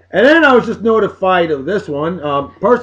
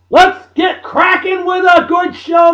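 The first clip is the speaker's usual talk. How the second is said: excited shout; 10 semitones higher, slower than usual. Real, not acted. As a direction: open the show with a big shout. Kicking off the show loudly.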